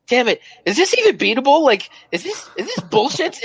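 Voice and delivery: high-pitched frustrated gamer outburst; exasperated. Retold or imitated. Gamer replaying his outburst after five hours of dying, exasperated.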